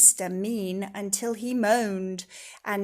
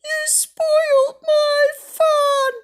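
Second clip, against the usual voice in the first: much higher, louder, slow crying whine; whiny wail; 13 dB louder, much slower than usual. Acted. Mister Tickle protests to Miss Magic, crying. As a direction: wail like a thwarted child. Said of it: "he cried".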